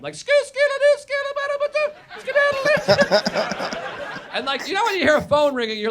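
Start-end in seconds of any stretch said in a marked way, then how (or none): 0.1-3.8 very high sung-out ringtone babble; ringtone imitation. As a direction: mimic a phone blaring at full volume. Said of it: "like, full volume".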